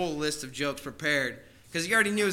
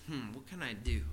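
softer, lower musing to himself; quiet pondering thought; inner thought; 5 semitones lower, 9 dB quieter than usual. Retold or imitated. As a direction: think it aloud under the breath. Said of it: "he thinks".

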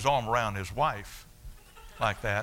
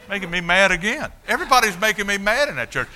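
heated recollection; 9 semitones higher, 8 dB louder than usual. Real, not acted. Recalls being angry.

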